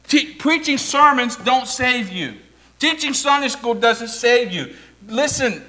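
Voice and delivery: normal talking voice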